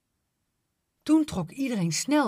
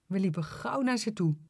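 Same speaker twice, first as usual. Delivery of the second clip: plain reading voice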